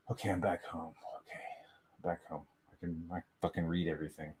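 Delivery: hushed, low self-talk; retold relief. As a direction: murmur it low, as if to yourself. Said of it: "that was like".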